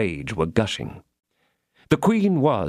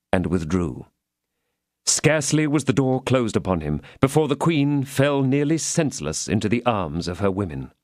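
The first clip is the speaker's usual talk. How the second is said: usual speaking voice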